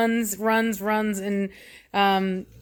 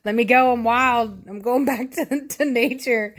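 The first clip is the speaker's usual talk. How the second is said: raised-pitch imitation of an angry cat; mock super-mad cat; 4 semitones higher than usual. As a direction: play the cat demanding freedom, half laughing. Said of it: "she's, like, super mad".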